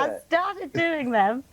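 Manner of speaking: repeats it with a laugh through the words. Real, not acted. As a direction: laugh it out while restarting the answer. Repeats answer while laughing.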